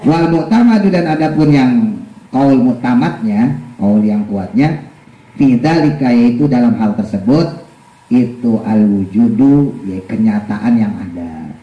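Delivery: slow, measured kitab recitation; deliberate teacher's text reading; reading a religious text aloud. Real, not acted. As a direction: read the Arabic and gloss it slowly. Reads and translates the text.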